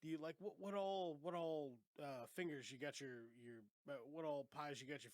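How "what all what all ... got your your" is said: ordinary talk, nothing marked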